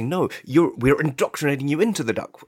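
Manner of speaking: ordinary talk, nothing marked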